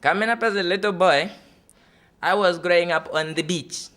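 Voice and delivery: put-on lightly Italian-accented father reminiscing. Italian father tells his childhood beach story, lightly accented. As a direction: tell it like a dreamy Italian dad. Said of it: "And he goes".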